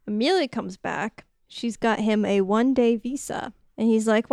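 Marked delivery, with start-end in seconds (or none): none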